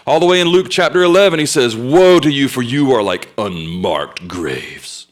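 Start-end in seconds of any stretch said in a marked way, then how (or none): 1.7-5.1 slow, weighty prophetic rebuke; hammering denunciation. Retold or imitated. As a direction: pronounce doom, drawing out the last words. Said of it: "he just hammers him".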